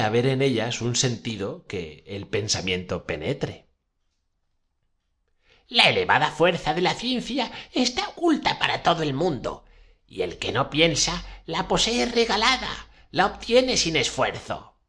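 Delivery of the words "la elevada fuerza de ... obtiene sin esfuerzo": put-on high old witch voice. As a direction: pitch up into an old crone. Witch resumes her recital. (voz aguda de bruja vieja)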